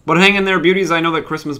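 high, loud cheer to the fans; bright rallying call; loud encouragement. Real, not acted. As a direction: pump up the viewers. Cheering viewers on.

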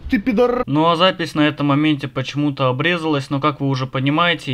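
ordinary talk, nothing marked

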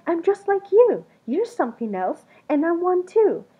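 high, loud, pleading and eager; urging him to see the likeness; eager cry. A narrator's reading. Creature pleads with Something Else, high and loud. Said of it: "the creature cried".

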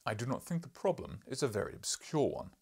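loud, booming lecturing professor; gruff professor. Acted. Professor lecturing the journalist, loud and booming. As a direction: boom like an irritable scholar.

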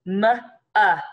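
"M, uh" as separate sounds, short u; sounded out. Shown as a demonstration. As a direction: isolate M, then short uh. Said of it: "Short U and one F sound".

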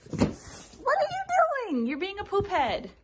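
very high, slow, teasing. Real, not acted.

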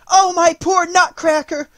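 high, loud anguished wail; sobbed cry. A narrator's reading. Marie seeing Nutcracker seized, anguished cry. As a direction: wail out in distress, drawn out. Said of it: "she sobbed".

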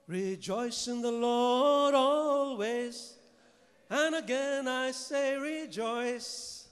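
slow, loud ringing scripture call; booming proclamation. Acted. Preacher to congregation, a slow booming proclamation. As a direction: proclaim it to the whole church.

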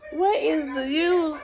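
slow, exasperated repeated question; slow, exasperated complaint; frustrated. Real, not acted. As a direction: drag each word out in frustration. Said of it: "I'm so frustrated, irritated".

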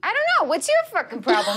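high, indignant comeback; defiant retort. Acted. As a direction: throw the challenge straight back. Acted quarrel, throwing it back.

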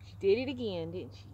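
ordinary delivery

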